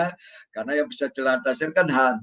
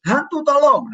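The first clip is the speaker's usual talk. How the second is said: higher, louder joking yell for help; mock panicked cry; 10 semitones higher, 6 dB louder than usual. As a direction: ham up a desperate shout to the ghost. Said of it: "Untung Petrus nggak salah ngomong".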